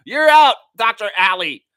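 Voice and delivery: high, loud umpire-style yell; booming, hammed-up ejection; mock firing. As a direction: shout a playful dismissal. Mock firing of Doctor Ali.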